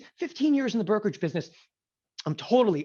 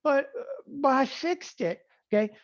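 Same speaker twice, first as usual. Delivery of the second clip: sheepish trader; 8 semitones higher than usual. Retold or imitated. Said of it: "You go".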